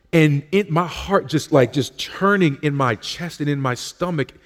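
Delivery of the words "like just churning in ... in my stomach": usual speaking voice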